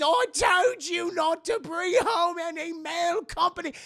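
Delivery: high, loud hammed-up scolding; acted angry landlady. Landlady hears them from downstairs, mock angry yelling. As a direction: yell it up the stairs in mock outrage.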